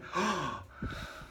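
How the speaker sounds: long high, soft gasp; mock gasp. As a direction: gasp in feigned astonishment. Gasp after his own question.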